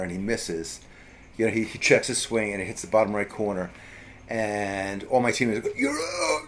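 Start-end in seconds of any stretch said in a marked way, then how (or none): 5.8-6.4 much higher, cheering call; mock teammate yell. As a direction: crow it like a gleeful kid. Teammates yelling at the strikeout.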